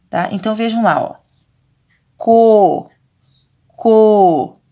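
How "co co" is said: CO with a long, loud held O; drawn-out vowel. Shown as a demonstration. Teacher helping children hear the vowel, drawn-out CO. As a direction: stretch the O in CO so it rings out. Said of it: "Eu já estou falando a vogal".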